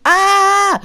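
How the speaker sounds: very high, loud imitated scream; mock scream. Imitating the masked man's scream as the ride drops, very high and loud. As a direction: let out a piercing imitation of his scream. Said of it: "He was just like".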